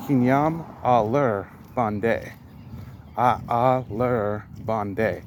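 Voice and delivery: "Kinyam a lur bonde" spoken slowly, each syllable spaced; slow careful repeat. Shown as a demonstration. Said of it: "I'll go over it one more time".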